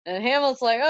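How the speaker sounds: usual speaking voice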